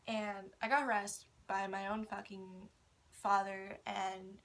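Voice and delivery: ordinary delivery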